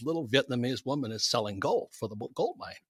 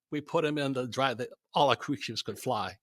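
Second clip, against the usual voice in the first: ordinary delivery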